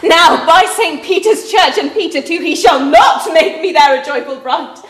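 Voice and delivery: defiant retort. Acted. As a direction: throw her own words back at her, leaning hard on "by". Juliet defiantly throws the words back.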